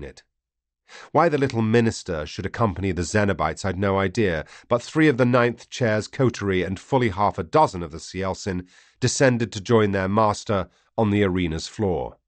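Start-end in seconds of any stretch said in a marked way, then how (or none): none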